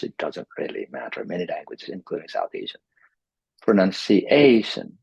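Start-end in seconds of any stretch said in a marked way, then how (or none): 3.6-5.0 "Pronunciation" slowed, with a drawn-out stressed A. Shown as a demonstration. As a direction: stretch and hit the AY in "Pronunciation". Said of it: "So here is the stress".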